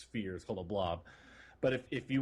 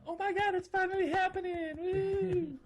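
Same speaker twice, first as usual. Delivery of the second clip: high, loud whoop of joy; shouting with real excitement; excited cheer; 3 dB louder than usual. Real, not acted. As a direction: cheer like the wait is finally over. Hanger module release excitement.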